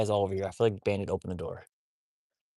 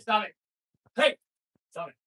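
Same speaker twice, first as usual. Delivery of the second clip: sharp command; 11 semitones higher, 8 dB louder than usual. Real, not acted.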